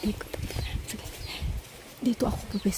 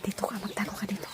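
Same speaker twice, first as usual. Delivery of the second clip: whispering while hiding; 12 dB quieter than usual. Real, not acted. Hiding before the prank.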